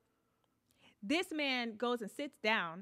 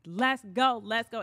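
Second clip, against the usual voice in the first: loud urgent order at usual pitch; retold yelling; 8 dB louder than usual. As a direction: yell at him to hurry. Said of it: "I'm yelling at him like".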